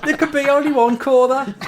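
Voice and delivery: loud, high mock-Brummie Highlander; Brummie accent. Friend joking about Brummie Highlanders, mock Brummie cry. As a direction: shout it as a Brummie warrior. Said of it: "if there were Brummie Highlanders".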